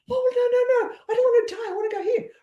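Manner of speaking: high, squeaky pleading "no, no"; frightened client. Retold or imitated. Client Glad refusing to die, high and pleading. Client voiced in a retold talk.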